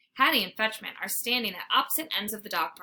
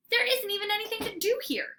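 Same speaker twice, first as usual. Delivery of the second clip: protest; 10 semitones higher than usual. Acted. Dog protesting.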